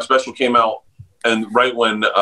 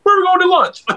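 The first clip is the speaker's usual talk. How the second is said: much higher, sudden bright switch; cheerful bus driver; 3 dB louder, faster than usual. Retold or imitated. Driver abruptly asking the comics about lunch, carefree. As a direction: flip to carefree like nothing happened. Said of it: "He goes".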